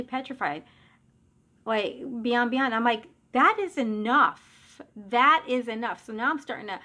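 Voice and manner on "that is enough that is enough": louder, slow and firm, each word set down; firm order. Retold or imitated. Mother ordering her dead father to stop, slow and firm. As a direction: lay down the law.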